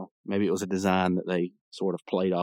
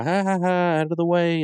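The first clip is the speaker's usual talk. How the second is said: jeering punk; 8 semitones higher than usual. Retold or imitated. Punk skateboarder shoving past two guys, mocking call. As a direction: jeer it out while barging past.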